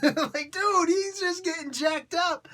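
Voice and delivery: high, drawn-out words through laughter; real cracked-up commentary; laughing hard. Real, not acted. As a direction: squeal it slowly through the laughs. Laughing at his own acted scene.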